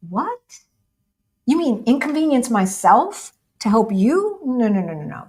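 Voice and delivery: high, swooping disbelief into brisk refusal; mock incredulous. American asked to help a lost stranger, mock incredulous. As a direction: play someone appalled at being asked a favor. Acts out unhelpful American's reaction.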